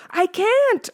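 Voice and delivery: high, loud protest; terrified boy insisting; panicked insistence. A narrator's reading. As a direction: refuse in desperation. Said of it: "I insisted".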